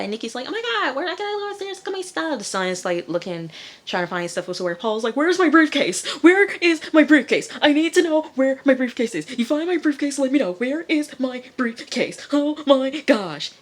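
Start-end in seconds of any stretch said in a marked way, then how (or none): 0.5-2.4 very high-pitched imitated panic; mock freak-out. Nikki rushing to check her stuff, mock panicked squeal. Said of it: "They're all freaking out". 5.2-13.5 high, loud hammed-up frantic demand; Paul freaking out. Paul demanding his missing briefcase, mock frantic shouting. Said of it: "Paul's freaking out".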